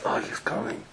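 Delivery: hushed, whispered dread; acted whisper under the breath; whispered aside. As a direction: whisper it to yourself as someone approaches. Niece privately sees uncle approaching.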